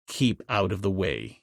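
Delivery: low, soft warning from the ape man; low, soft, guarded warning. A narrator's reading. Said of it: "he said in a low voice".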